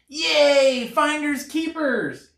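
high, loud, excited cry; excited squirrel. A narrator's reading. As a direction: squeal it with glee, then slow down. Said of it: "he cried".

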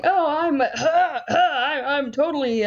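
stammering mock patient; mock flustered patient. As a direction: play a flustered patient caught out. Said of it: "I can be like".

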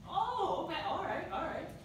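high, bright dog praise; happy surprised praise for the dog; excited praise. Real, not acted. Trainer surprised by dog's move.

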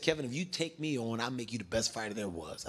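lightly voiced low trainer making an offer; imitating a trainer, low and understated; trainer's pitch.

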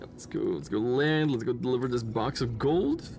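ordinary talk

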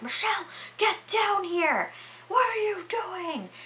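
high-pitched mock scolding, no extra volume; scolding man's demands. Howard ordering Michelle from the doorway.

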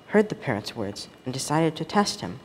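plain reading voice

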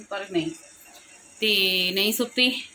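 ordinary delivery

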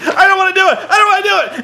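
very high, loud frightened squeal; mock panic. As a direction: squeal it like a hammed-up coward. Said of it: "he starts freaking out".

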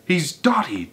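high-pitched exclamation; crying out. A narrator's reading. Helpers declare Mugglewump crazy, crying out. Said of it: "they cried".

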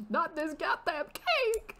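high, drawn-out mock wail; hammed-up tearful protest; mock crying. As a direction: wail it in exaggerated distress. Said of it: "crying harder now".

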